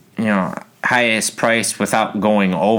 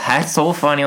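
higher, fake-sweet innocence; mock innocent; 8 semitones higher than usual. Sneaky bidder acting cute after upbid, fake-sweet. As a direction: play the sneaky bidder coy. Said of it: "they try to be like".

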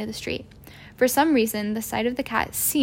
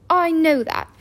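sharp, higher and louder retort; irritable; 6 semitones higher, 5 dB louder than usual. A narrator's reading. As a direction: cut him off, annoyed. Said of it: "said Professor McGonagall irritably".

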